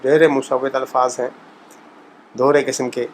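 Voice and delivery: ordinary talk, nothing marked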